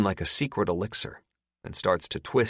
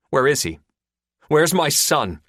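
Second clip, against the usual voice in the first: urgent agent, pressing hard, louder; tense lawman, raised voice; Linder demanding; 8 semitones higher, 7 dB louder than usual. Acted. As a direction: demand the answer from the captor. Linder demanding his son.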